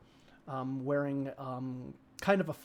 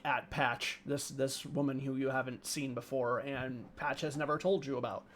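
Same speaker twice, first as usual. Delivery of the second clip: ordinary talk, nothing marked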